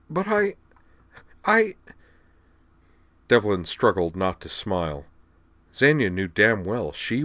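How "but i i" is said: stammering. A narrator's reading. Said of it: "stammer a bit".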